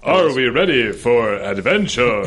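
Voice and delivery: slow, hammed-up hero call; lightly voiced superhero rallying the team; Aquaman voice. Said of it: "Oh, Aquaman is here".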